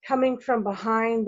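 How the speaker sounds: plain reading voice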